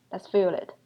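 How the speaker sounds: ordinary delivery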